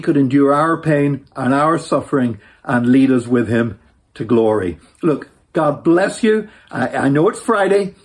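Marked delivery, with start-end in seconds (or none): none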